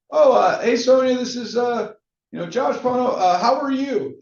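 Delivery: high, stumbling fake-friendly pitch; hesitant salesy opener. Imitating agents fumbling a cold call, hesitant and salesy. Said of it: "sometimes people are like".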